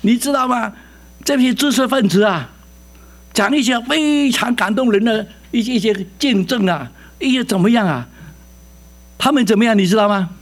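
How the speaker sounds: a hint of an excited pastor; mildly animated pastor explaining; quoting a pastor. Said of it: "姓胡的牧师跟我讲".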